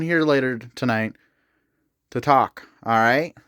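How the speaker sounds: addressing spirits. Real, not acted. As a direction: speak clearly and patiently to an unseen listener.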